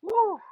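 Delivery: high, loud whoop; joyful whoop. Real, not acted. Streamer whooping after cracking herself up. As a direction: cheer for your own pun. Cheers at own joke.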